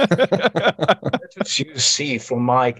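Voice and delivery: very slow, drawn-out fake British accent; lightly faked pompous British accent. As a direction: savour each word in a mock British accent. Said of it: "I'll put on the fake accent".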